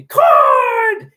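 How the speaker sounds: throaty, booming announcer's bellow; loud, drawn-out commentator cry from the throat; Kevin Harlan. Retold or imitated. Announcer celebrating a Steph three, throaty bellow. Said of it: "I do that Kevin Harlan voice".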